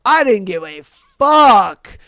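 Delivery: ordinary talk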